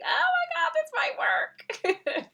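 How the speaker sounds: high, loud, very slow, laughing through it; giddy excitement. Retold or imitated. Herself the night before, high giddy laughing hope. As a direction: gush like a smitten girl. Giddy inner voice about the guy.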